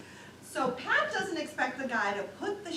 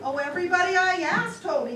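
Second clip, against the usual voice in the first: high, loud protest; insist, voice jumping up; a flustered reporter pleading louder; exasperated; 7 semitones higher, 8 dB louder than usual. Acted. Reporter pressing exasperated manager.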